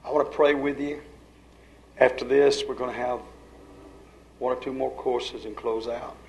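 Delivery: quiet, reverent invitation, leaving space between phrases; hushed altar call. Real, not acted. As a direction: gently draw the congregation into prayer.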